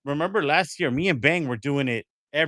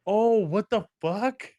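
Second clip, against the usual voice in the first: slow, drawn-out disbelief, pitched higher; stunned surprise; 5 semitones higher, much slower than usual. Real, not acted. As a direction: let it stretch out in shock. Hearing the stream story twist.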